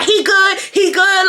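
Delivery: high, insistent imitated dismissal; shooing her away. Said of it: "she was shooing her away".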